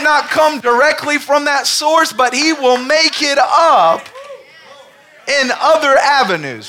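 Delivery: high, loud, slow preaching climax; loud, slow pulpit preaching; full-force preaching. Acted. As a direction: preach it out big and drawn out. Preaching to the congregation.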